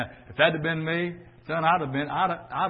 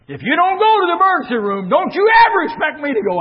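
high, loud, fast angry yell at his wife; furious shout; 14 semitones higher, 11 dB louder than usual. Retold or imitated. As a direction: lose your temper and blast the ultimatum. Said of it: "I mean, I lost it".

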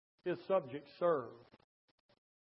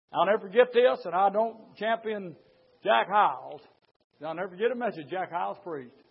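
ordinary talk

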